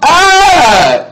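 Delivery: high, loud whoop; playful scream. Real, not acted. Storyteller after shouting out auntie, playful high scream. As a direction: let out a loud high yell mid-laugh.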